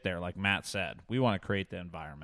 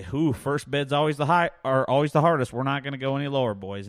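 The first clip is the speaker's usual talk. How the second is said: auctioneer pitch; 5 semitones higher, 7 dB louder than usual. Retold or imitated. Auctioneer coaxing bidders.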